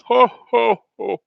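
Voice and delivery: high, loud hammed-up Santa laugh. Trader playing Santa for a chat viewer, jolly hammed-up ho-ho-ho. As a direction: boom out a jolly mock Santa ho-ho-ho.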